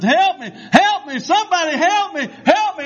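high-pitched imitated cries for rescue; retold screaming. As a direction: holler it as a trapped, dying man. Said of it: "he was screaming and hollering".

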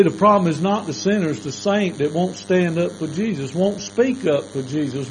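normal talking voice

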